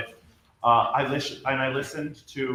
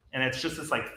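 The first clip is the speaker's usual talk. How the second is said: ordinary delivery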